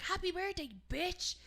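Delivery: high-pitched birthday cry; spring the surprise, retold with glee; cheeky surprise greeting. Acts out surprising birthday girl.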